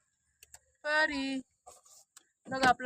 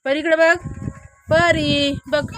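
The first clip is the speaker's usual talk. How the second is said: raised calling voice, real; 5 semitones higher, 6 dB louder, slower than usual. Real, not acted. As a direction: call her over from a distance. Calling to child across field.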